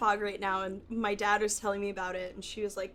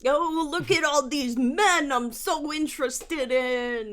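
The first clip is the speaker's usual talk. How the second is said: slow, loud, higher Boston bro voice; Boston straight pride voice; 4 semitones higher, 4 dB louder, slower than usual. Pretend. Infiltrating straight pride as a loud slow Boston bro. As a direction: ham it up as a beer-loving Boston guy at a parade. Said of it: "That's my straight pride voice in Boston".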